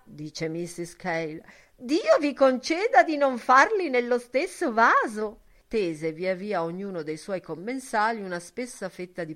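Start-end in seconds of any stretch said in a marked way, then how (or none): 1.8-5.4 very high, loud simpering granny; mincing old woman. A narrator's reading. Buck Mulligan playing missus Cale in a high simpering voice. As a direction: squeal it like a prim old lady. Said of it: "parlare smanceroso".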